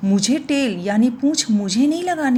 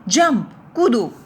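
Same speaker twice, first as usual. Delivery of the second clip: a louder call to jump; shouting; 2 semitones higher, 5 dB louder than usual. Retold or imitated. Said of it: "I shouted".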